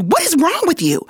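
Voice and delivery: high-pitched mock disbelief; insistent, high rebuke; exasperated retold coach. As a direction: imitate a coach's incredulous scolding, pitch way up. Coach repeats scolding more insistently.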